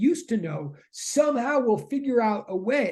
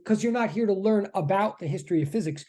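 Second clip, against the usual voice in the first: ordinary talk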